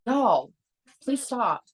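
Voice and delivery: exasperated plea. Real, not acted. Mom scolding kids mid-meeting.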